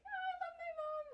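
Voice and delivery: high, loud teary cry of love; teary outburst. Retold or imitated. Daughter recalling crying in her mom's arms, high and teary. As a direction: let the tears break through the words. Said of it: "I started crying".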